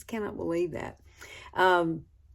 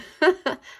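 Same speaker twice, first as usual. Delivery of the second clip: laugh; 9 semitones higher, 9 dB louder than usual. Real, not acted. Laughs at own joke.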